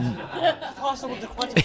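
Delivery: soft laugh. Real, not acted. Laughing at the question.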